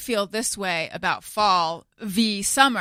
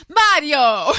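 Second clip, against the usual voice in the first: Mario called out louder and higher, with a hammed-up Italian roll; mock the coworker belting Mario the Italian way; overdone Italian Mario; 6 semitones higher, 9 dB louder than usual. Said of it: "she's like".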